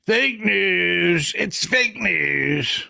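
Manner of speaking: stretched-out mocking catchphrase; amateur impression, long drawn vowels; Donald Trump. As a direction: drag each word out in a rough politician imitation. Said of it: "I've gotta work on my impression".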